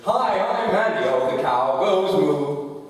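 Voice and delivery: loud, high, slow goofy character; American character voice. Acted. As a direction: belt it out high and slow like a dopey cartoon guy. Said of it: "this American character that goes".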